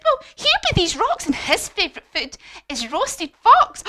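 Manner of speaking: high, loud mouse voice; animated, projected storybook mouse; mouse giving a warning. Acted. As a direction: build to the scary punchline. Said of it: "And he said".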